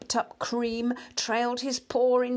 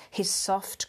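plain reading voice

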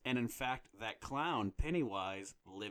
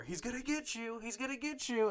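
high, taunting mock scream through a door; mock scary scream; 14 semitones higher, 4 dB louder than usual. Grandma taunting the locked-in child through the door, high mock scream. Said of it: "She's screaming through the door".

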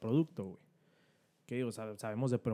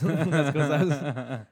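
slow, laughing through the words; louder, drawn out with laughter; 4 semitones higher, 8 dB louder, much slower than usual. Real, not acted. Repeated phrase, laughing through it.

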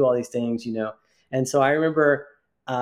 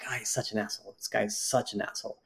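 muttered aside; 4 semitones lower, 11 dB quieter than usual. Retold or imitated. Private thought during the workshop argument.

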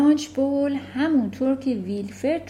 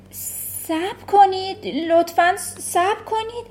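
higher, a touch panicky pleading; frightened pupil's voice; flustered boy; 9 semitones higher, 3 dB louder than usual. Acted. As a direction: stammer through the sum, lost. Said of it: "کاملاً گیج شده بود گفت".

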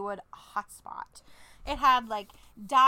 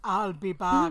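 put-on low, heavy Arnold Schwarzenegger accent; a hint of the Terminator's Austrian accent; Arnold impression; 5 semitones lower, slower than usual. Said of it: "do someone do a good Arnold".

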